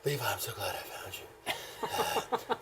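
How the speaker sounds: put-on low, slow, very soft drunken murmur; blackout drunk. Drunk man greeting his bus seatmate, slurred and murmured. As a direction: mumble it like someone wasted.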